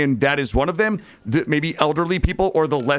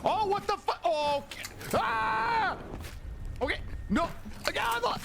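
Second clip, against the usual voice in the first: panicked streamer; 10 dB quieter than usual. Real, not acted. Panicked in horror game.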